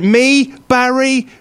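very high, over-spelled explanation; high mock-condescending old man; patronising. Old man explains food to foreigner.

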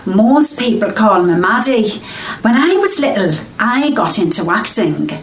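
a dialect coach's shaky Scouse, slipping between regions; wandering Liverpool accent. Acted. Dialect coach showing off her Liverpool accent, drifting Scouse. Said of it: "guess what accent she's doing".

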